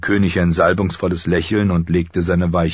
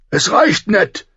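much higher, loud angry warning; warning king; 5 dB louder, slower than usual. Acted. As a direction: warn him off sharply. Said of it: "warnte er". (wütende Warnung, laut und hoch)

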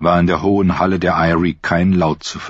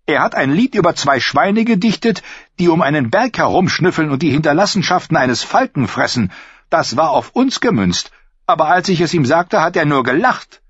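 higher, aggrieved complaint; a hint of indignant knight; 10 semitones higher than usual. A narrator's reading. Royce complaining to the lords about the singer, indignant. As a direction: sound wronged and insulted. Royce voicing his grievance.

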